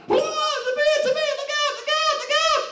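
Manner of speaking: shrieking high, loud mom voice; mock-panic like a frightened mother; high squealing warning about a bee; panicked mom. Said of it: "my mom just, like, drags us both down and goes".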